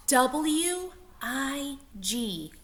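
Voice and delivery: letters spaced out, slow; spelled out slowly. Shown as a demonstration. Teacher spelling the word for the class, slow. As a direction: spell each letter apart. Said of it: "spelling letters slowly".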